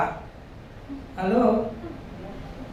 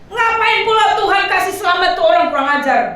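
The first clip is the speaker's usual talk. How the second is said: irritated Jonah; 6 semitones higher than usual. Retold or imitated. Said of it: "Kira-kira gitu jawab bahasa kita sekarang".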